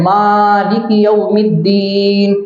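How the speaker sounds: مالک with a long drawn ما and long دین; slow recited مالک یوم الدین with long vowels; lengthened vowels. Shown as a demonstration. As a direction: stretch the alif in مالک and the ya in الدین. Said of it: "ماں کے الف کو کھینچیں".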